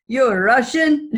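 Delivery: loud, slow imitated Russian accent; thick Russian accent. Cab driver turns around to question passenger, imitated thick Russian accent. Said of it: "with a really thick Russian accent".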